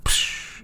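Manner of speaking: soft, voiceless "psh" poof; imitated vanishing whoosh. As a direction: puff out a quiet whoosh for someone vanishing.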